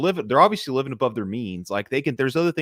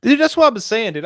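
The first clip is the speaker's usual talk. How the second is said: excited outburst; 9 dB louder than usual. Real, not acted. Eager reply to the listener's joke.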